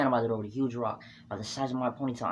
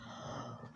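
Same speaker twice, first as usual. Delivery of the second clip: sharp pained gasp; 15 dB quieter than usual. Retold or imitated. Hit in the back by rock.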